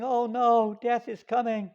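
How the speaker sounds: high, loud groan of a dying king. Acted. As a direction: moan it like a king on his deathbed.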